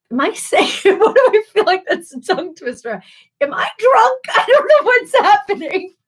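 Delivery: breaking up with giggles mid-sentence; tipsy giggling. Real, not acted. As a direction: laugh it out, a bit tipsy. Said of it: "I might be a little tipsy".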